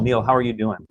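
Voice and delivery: ordinary delivery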